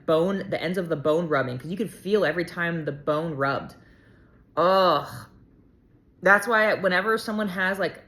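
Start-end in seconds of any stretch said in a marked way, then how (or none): 4.6-5.1 loud pained "ugh"; wincing, loud groan; groan of disgust. Real, not acted. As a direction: groan at the remembered pain. Recalling bone ends rubbing.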